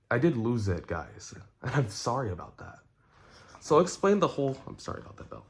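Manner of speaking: ordinary talk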